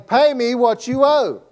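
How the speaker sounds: angry demand. Acted. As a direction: grab him by the throat and demand it. Servant choking debtor, acted demand.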